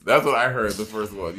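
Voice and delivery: ordinary talk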